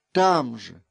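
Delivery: plain reading voice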